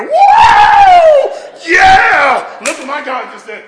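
huge high victory whoops; imagining cheering at God's fire; jubilant shout. Pretend. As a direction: explode with joy at the miracle. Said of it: "I would be like".

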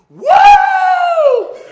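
long, very high, loud "woo"; explosive joyful whoop. Retold or imitated. Brother exploding at news of salvation, joyful whoop. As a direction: let pent-up joy erupt in one sustained whoop. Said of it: "he let it explode like Old Faithful".

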